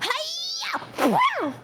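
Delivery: high-pitched "Hi-ya!" battle cry; hammed-up martial arts yell; high karate shout. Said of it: "and then she goes".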